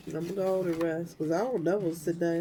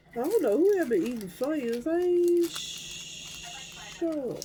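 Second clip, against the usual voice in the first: puzzled drawl; 10 semitones higher, slower than usual. Real, not acted. Puzzled over found Funyuns.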